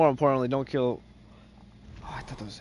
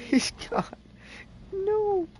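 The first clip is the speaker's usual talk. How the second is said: squealed high and loud; high laughing squeal; 5 dB louder, slower than usual. Real, not acted.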